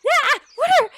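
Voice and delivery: high, loud yelp of shock; startled shriek. Acted. Ella startled by her fairy godmother, shrieking. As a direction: jump and cry out, words cut off.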